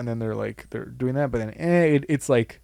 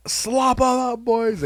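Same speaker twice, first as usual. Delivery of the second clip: high, put-on hype-man voice; chanting slop em up like a rowdy guy; imitating sketch character; 9 semitones higher, much slower than usual. Podcaster quoting a comedy sketch line to his cohost, in a high put-on voice.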